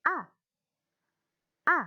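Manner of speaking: A falling sharply from high; falling fourth tone. Shown as a demonstration. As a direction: snap A downward like an angry word. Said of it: "seperti orang marah".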